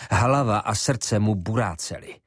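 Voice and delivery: usual speaking voice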